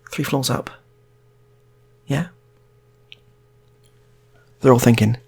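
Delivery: ordinary talk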